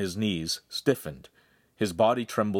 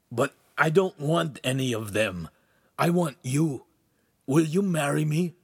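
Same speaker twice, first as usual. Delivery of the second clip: higher-pitched, earnest marriage proposal; pleading suitor; 7 semitones higher than usual. A narrator's reading. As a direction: propose with a hopeful plea. Proposing marriage on his knees.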